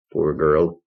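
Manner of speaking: ordinary delivery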